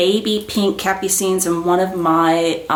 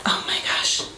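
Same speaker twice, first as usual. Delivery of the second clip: breathless whisper, softer; awed whisper; 6 dB quieter than usual. Real, not acted. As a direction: whisper it as if the bag takes your breath away. Awed by the bag.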